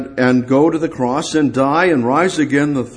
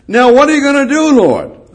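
high, loud jeering challenge from the crowd; mocking crowd jeering at the crucified Lord; mocking taunt; 12 semitones higher, 7 dB louder than usual. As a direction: sneer it up at him like a heckler. Crowd taunting Jesus on cross.

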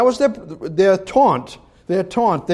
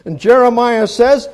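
usual speaking voice

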